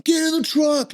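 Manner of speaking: high, shouted American order; mock American shout; exaggerated American accent. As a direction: overdo the American official barking orders. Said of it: "obviously over-exaggerating, it's American".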